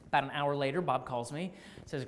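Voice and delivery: ordinary talk, nothing marked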